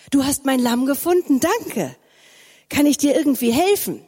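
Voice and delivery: lightly voiced boy, eager to help; eager young boy. A narrator's reading. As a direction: lift it up, a grateful little shepherd. Boy Simon thanks the stranger.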